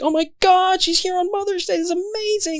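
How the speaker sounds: high, breathless fangirl voice; squealing excited diner voice; gushing diner. Retold or imitated. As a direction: gush like a starstruck guest. Guests gushing over celebrity chef.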